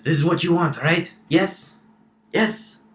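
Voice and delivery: a hint of a pushy skipper, questioning; Salamander. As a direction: check eagerly with the passengers. Game master speaks as Salamander.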